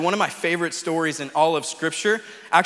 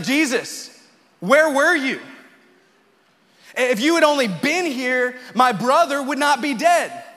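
raised, higher, louder accusing cry; mock yell of a grieving sister, pitched up; yell at Jesus; 6 semitones higher, 3 dB louder than usual. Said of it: "begins to yell at Jesus".

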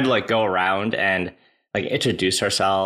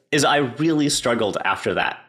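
normal talking voice

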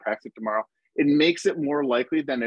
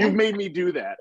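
laughing; 7 semitones higher than usual. Real, not acted. Joking accusation, laughing.

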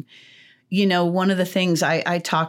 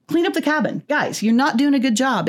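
sharp, raised, bossy counselor voice; harsh scolding; 8 semitones higher than usual. Pretend. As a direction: bark the order at the campers. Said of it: "instead of just coming right in bold, aggressive".

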